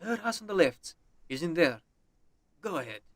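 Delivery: put-on Indian accent. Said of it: "I'm also doing the Indian accent for the local".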